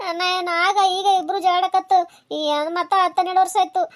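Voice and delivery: ordinary talk, nothing marked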